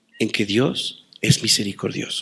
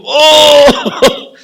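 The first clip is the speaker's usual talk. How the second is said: a loud, aching cry from the gut; anguished shout; 12 dB louder than usual. Acted. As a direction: let the oh burst out with pity. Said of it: "desde las entrañas".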